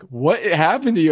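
high-pitched mock astonishment; imitated astonished manager. Manager reacting to soaked employee.

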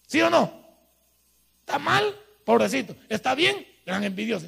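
loud, insistent preacher voice; preaching at full force. Acted. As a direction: hammer the point home to the congregation.

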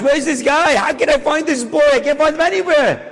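high, urgent desperation; desperate searching. Retold or imitated. Husband searching the archives, high and desperate. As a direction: plead it, hunting everywhere.

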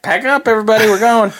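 mock alarmed call. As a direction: call everyone out like a mock evacuation. S1 imagines fleeing the snake.

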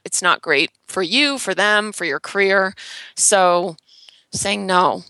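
normal talking voice

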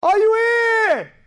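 high, loud, long-drawn call; screaming. Retold or imitated. Rescuer calling for the hiding boy, screaming. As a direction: stretch the shout out to carry far. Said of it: "and he's screaming".

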